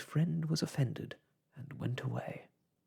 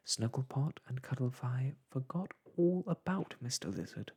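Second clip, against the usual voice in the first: usual speaking voice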